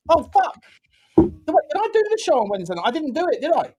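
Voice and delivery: high-pitched dawning panic; sudden alarm. Real, not acted. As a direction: blurt it as you realise you forgot. Suddenly realises he forgot a show.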